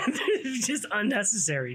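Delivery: laughing through the words; amused laugh while talking. Real, not acted. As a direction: chuckle it out in disbelief. Laughing at homie's pointless gun.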